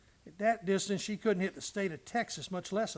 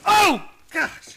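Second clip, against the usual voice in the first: sharp high cry of shock, much louder; shot and startled; 11 semitones higher, 14 dB louder than usual. Acted. Clancy suddenly grazed by a bullet, startled loud cry. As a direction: yelp as the bullet hits.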